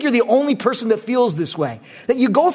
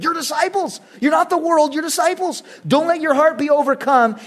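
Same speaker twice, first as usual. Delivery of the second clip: high, forceful insistence on you're; emphatic command; 6 semitones higher than usual. Acted. Pastor voicing Jesus to his disciples with emphatic urgency. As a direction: press the command home to the disciples. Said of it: "It's an emphatic command".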